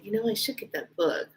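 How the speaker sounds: very soft inner-thought voice, sinking to a whisper; imitating silent musing, barely voiced; thinking to herself. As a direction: murmur it as a private thought. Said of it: "I didn't say it out loud".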